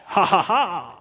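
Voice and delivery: ordinary talk, nothing marked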